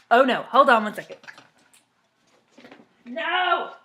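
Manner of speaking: loud alarmed oh no, then high no; alarmed loud call. Real, not acted. Reader yelling at the cat mid-story, alarmed. Cat eating something important.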